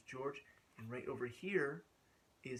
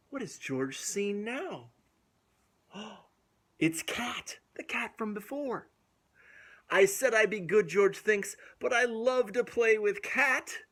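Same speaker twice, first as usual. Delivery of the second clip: lively storybook reading with gasps; reading to children; 5 semitones higher, 11 dB louder than usual. A narrator's reading. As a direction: read it aloud to little kids. Reads picture book to children.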